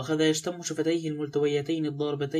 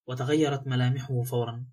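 ordinary delivery

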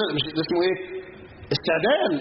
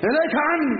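high-pitched mock brush-off; retold rebuff; 8 semitones higher than usual. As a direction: push the listener away, pitched well up. يحكي رد امرأة مصابة زاجرة. (صدّ بنبرة عالية على لسان امرأة)

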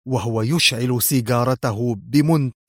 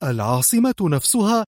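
higher, astonished objecting cry; shocked protest; 7 semitones higher than usual. A narrator's reading. Aide hearing the capital is the target, shocked cry. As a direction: exclaim it in disbelief. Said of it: "وهتفت مستنكرة".

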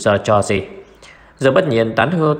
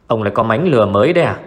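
ordinary delivery

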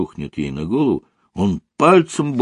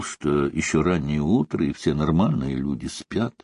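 ordinary delivery

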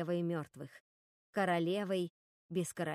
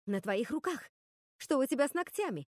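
insistent question rising higher; demanding; 5 semitones higher than usual. A narrator's reading. Готель questions Руби about her nails, demanding an answer. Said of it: "потребовала ответа Готель".

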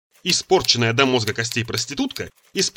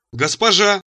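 higher-pitched happy greeting; beaming delight; 7 semitones higher, 4 dB louder, slower than usual. A narrator's reading. Cheerful slave spots master's mother, delighted. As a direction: greet her with a broad grin. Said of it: "расплываясь ещё шире".

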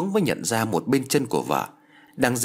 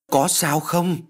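alarmed question; 6 semitones higher, much slower than usual. A narrator's reading. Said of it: "Anh ta vừa hỏi mấy tiếng đó".

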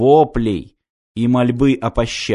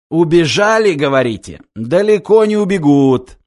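higher, louder scornful boss voice; mocking commandant; 4 semitones higher, 4 dB louder than usual. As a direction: sneer down at useless underlings. Commandant quote.

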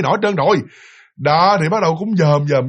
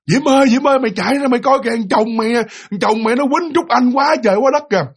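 high, raised retold cry of alarm; urgent calling out; 6 semitones higher than usual. Friend calling Diễm to come see the fight, urgent shouting. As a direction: call her out in mock panic. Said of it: "bắt đầu nghe la".

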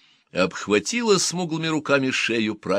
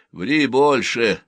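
grumbled retort; 4 semitones higher, 6 dB louder, slower than usual. Acted. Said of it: "буркнул Гэт".

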